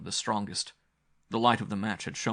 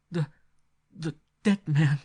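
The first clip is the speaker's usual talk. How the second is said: halting, shaken stammer, louder and higher; stammering in fear; 8 semitones higher, 7 dB louder than usual. A narrator's reading. Frightened girl stammers to the narrator about the corpse, halting. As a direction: stumble over the words, frightened. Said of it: "she stammered".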